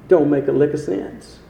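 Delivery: usual speaking voice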